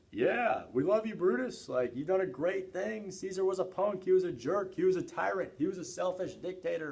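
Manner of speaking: high, gushing crowd voice; excited crowd. Retold or imitated. Teacher voicing the plebeians praising Brutus, high and gushing. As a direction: cheer for Brutus like a fickle mob.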